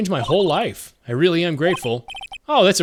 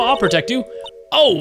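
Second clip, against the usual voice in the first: ordinary talk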